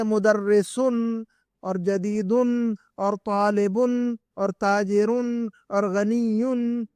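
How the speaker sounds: slow list from مدرسن to غنیون, each ending in a held -un; tanween endings. Shown as a demonstration. Teacher shows students examples of tanween, drawn-out -un endings. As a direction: draw out the final "-un" on مدرسن, جدیدن and the rest. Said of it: "یہ تنوین جو لگا رہے ہیں".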